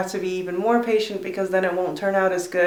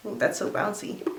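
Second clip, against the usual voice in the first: whispering; 8 dB quieter than usual. Real, not acted. Admiring curl, soft aside.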